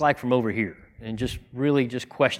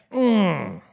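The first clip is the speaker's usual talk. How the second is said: higher, drawn-out wincing groan; pained "mm"; 7 semitones higher, 4 dB louder than usual. Retold or imitated. Reader stung by what he read, pained groan. Reacting to a convicting passage.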